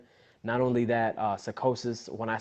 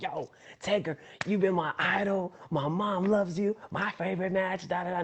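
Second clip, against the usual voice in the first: excited fan; 6 semitones higher, 4 dB quieter than usual. Retold or imitated. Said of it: "I'm excited. I'm hyped".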